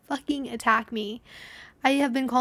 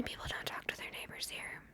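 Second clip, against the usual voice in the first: hushed, secretive whisper; whispered aside; 16 dB quieter than usual. Real, not acted. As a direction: lean in and whisper it.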